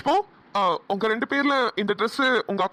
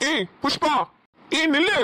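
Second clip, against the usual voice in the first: sharp, higher-pitched call; shouting after her; 6 semitones higher than usual. Acted. Young man ordering the upset girl to stop, shouting angrily. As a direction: call her back angrily as she leaves. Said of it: "அவகிட்ட சத்தமா பேசாதீங்க".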